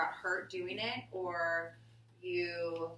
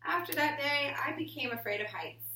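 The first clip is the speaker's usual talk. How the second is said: put-on reading to children. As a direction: read it to the class like a picture book. Reading picture book aloud.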